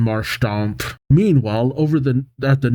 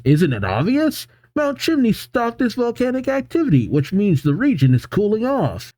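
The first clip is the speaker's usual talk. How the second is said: high-pitched mild exasperation; lightly acted irritated explainer, pitch raised; angry assistant; 8 semitones higher, 3 dB quieter than usual. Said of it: "The angry assistant says".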